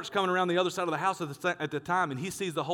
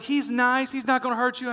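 lightly voiced lady dog owner, higher; a hint of an unworried owner, pitched up; imitated reassuring owner; 7 semitones higher, 3 dB louder than usual. Lady vouching for her dog, lightly imitated reassurance.

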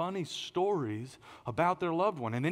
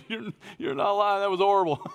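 higher, louder, through laughter; laughing agreement; 5 semitones higher, 5 dB louder than usual. Retold or imitated. Preacher agrees with his critic, laughing. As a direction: laugh while owning up to it.

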